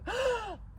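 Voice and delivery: sharp high gasp of realization; shocked gasp. Pretend. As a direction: gasp as if you just woke up to it. Sudden realization in the night.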